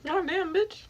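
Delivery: high shocked exclamation. Real, not acted. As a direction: squeal it in disbelief.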